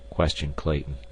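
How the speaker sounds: ordinary delivery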